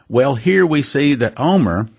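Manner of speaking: usual speaking voice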